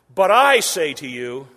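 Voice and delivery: loud, slow, emphatic proclamation; Jesus proclaiming. Retold or imitated. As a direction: declare it with full authority. Preacher voicing Jesus' sermon.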